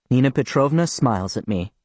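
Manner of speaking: usual speaking voice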